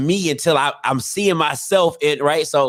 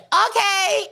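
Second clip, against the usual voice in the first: put-on much higher little girl voice; imitating daughter; slower than usual. Imitating his daughter's okay, high and light. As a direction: squeak out okay like a child. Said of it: "She's the one that's like".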